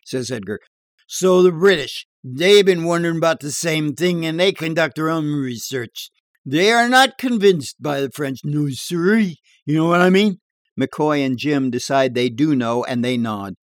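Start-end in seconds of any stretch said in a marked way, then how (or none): 1.1-10.4 higher, louder bar yarn voice; tall-tale barfly. Acted. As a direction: spin a dirty joke in a bar.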